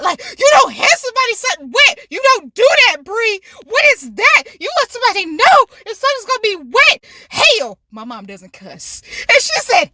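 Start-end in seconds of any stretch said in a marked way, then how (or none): 0.4-7.8 high-pitched mock scolding; mad mom. Mom scolding Brie over wet sanitizer, mad. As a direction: imitate mom's quick, heated telling-off. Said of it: "she's just mad".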